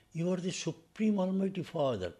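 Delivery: exalted proclamation; proclaimed. Shown as a demonstration. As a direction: proclaim it to the whole room. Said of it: "repeated after quiet take".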